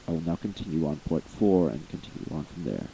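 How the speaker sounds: usual speaking voice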